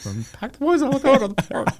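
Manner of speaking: high, put-on carefree voice; a goofy high voice, laughing it off; mock-cheerful dad leaving for the park; mock chirpy self. Mock self-quote to wife.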